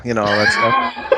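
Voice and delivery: ordinary talk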